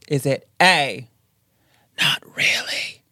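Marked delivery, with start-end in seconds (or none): 2.0-3.0 slow whispered ghost imitation; spooky EVP-style whisper; ghostly whisper. Voices option as the ghost.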